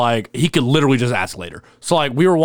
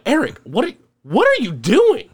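incredulous; 12 semitones higher than usual. Retold or imitated. To a drunk friend who slammed the bottle, high and incredulous. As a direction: ask in shocked disbelief, stretching it out.

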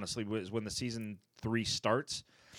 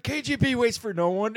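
high, loud mock-menacing; retelling a coworker's hammed-up KGB threat, high and loud; hammed-up KGB line; 11 semitones higher, 6 dB louder than usual. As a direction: deliver it like a cartoon threat. Jim mock-menacing after the slap.

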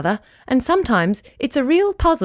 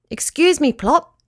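higher, calling across the creek; 7 semitones higher than usual. Acted. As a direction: call politely to the pelican. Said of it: "Shaggles called to the pelican".

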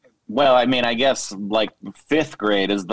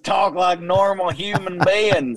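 higher, hammed-up Southern accent; 7 semitones higher than usual. American guest telling the British hosts to talk normal, in a hammed-up Southern accent. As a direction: lay the Southern accent on thick. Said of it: "that was the best Southern accent ever".

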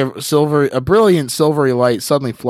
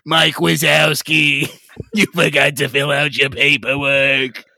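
slow, high impression; Roz voice; 4 semitones higher than usual. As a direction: drawl like a bored clerk. Quoting Monsters Inc villain joke.

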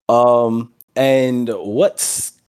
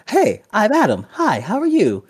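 high, bright nerdy greeting; character voice; 11 semitones higher than usual. Acted. GM voicing Adam greeting the pixie, high and eager.

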